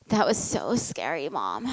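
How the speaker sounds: put-on weepy kid voice, trailing off; tearful little boy. Son just off the ride, telling mom he was scared, tearful.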